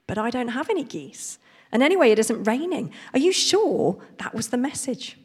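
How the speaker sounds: high, puzzled farmer's protest; baffled farmer. Acted. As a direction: sound baffled and doubtful. Farmer questions the muddled message.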